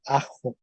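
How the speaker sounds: "ajo" with a throaty Spanish j; Spanish jota. Shown as a demonstration. Teacher gives the class the Spanish outcome, throaty jota. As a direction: say "ajo" as a-kho. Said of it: "La L se transforma en ja".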